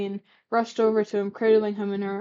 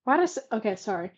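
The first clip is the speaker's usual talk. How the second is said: ordinary delivery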